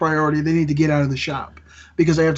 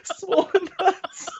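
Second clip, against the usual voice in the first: drawn-out high squeaky echo of swollen nuts; mock high echo; 12 semitones higher, much slower than usual. Echoing a friend's crude phrase in a slow, high mock squeak.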